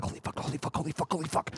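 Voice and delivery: rapid-fire repeated whisper under the breath; panicked whisper. Retold or imitated. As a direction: whisper it to yourself, starstruck. Muttering to self while walking away.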